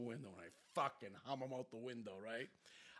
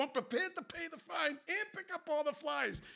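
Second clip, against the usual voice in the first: high-pitched mock begging; re-enacted pleading; 14 semitones higher, 4 dB louder than usual. Driver offering to pay the fine, high pleading. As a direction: offer it desperately to the cop.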